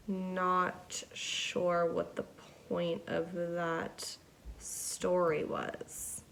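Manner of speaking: unvoiced, slow whisper trailing off. Real, not acted. Musing to viewers about the pointless story, whispered.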